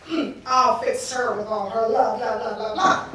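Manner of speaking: low grumbled mockery; dismissive scheming. Spurned god plotting against the goddess, low and dismissive. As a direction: drop low and trail into scornful blahs.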